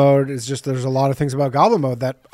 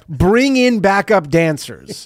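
incredulous reading; 4 semitones higher, 3 dB louder, much slower than usual. Retold or imitated. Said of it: "this is the craziest one".

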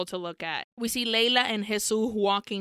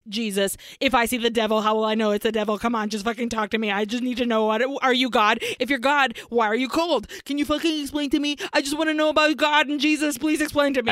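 fast, frantic, louder pleading; freaking out; 2 semitones higher, 4 dB louder, much faster than usual. Retold or imitated. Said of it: "Sion is freaking out".

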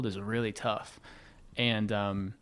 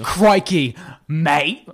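loud, raised mock British-Australian exclamation; put-on British-Australian accent; 4 semitones higher, 7 dB louder, much slower than usual. Shown as a demonstration. Answering his best accent, loud mock British-Australian. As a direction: belt out a bad British-Australian catchphrase. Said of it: "What's the best accent you could do?".

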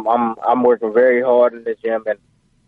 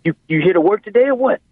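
raised-pitch imitation of a demanding dad; dad confronting son; 9 semitones higher, faster than usual. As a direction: call him out like an impatient father. Retold dad confronting his son.

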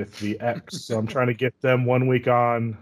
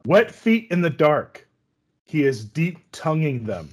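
aggressive haiku reading; 4 semitones higher, 5 dB louder, slower than usual. Pretend. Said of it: "Can I read to you, uh, an aggressive haiku?".

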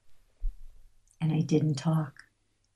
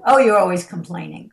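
loud mock-irritated retort; dismissive partner; 7 dB louder than usual. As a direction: snap back at a complaining partner.

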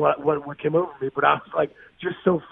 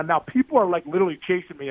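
normal talking voice

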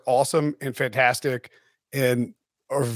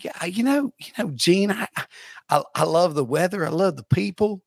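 lightly hammed-up Southern accent; fake Southern accent; 2 semitones higher than usual. Coach in game-day interview praising grits, fake Southern accent. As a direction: stammer through a put-on Southern accent. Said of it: "just faking a Southern accent".

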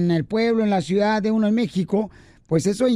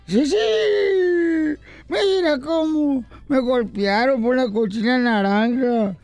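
put-on crying boy; 3 semitones higher than usual. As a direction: drag it out like a sulking kid. Crying boy voiced in joke.

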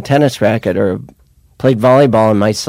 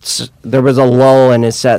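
ordinary talk

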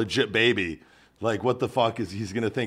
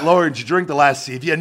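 loud, raised pitch, playful interrogation; mock accusing question; 5 semitones higher, 8 dB louder than usual. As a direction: confront your wife over a trifle, hammed-up. Acted husband questioning his wife.

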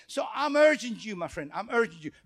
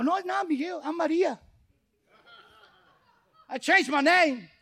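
higher, playful fake voice pretending to be Maria; mock woman's voice; 5 semitones higher than usual. To the crowd calling his name, a higher playful mock voice. Joking he is someone else.

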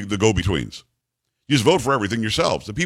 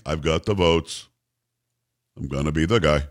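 Mitch McConnell impression; 8 semitones lower than usual. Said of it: "decided to tell everybody".

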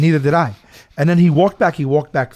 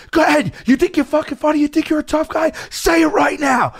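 furious shout; 12 semitones higher than usual. Retold or imitated. Wrestler screaming at the heckler in his face, furious yell. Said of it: "he goes, he goes".

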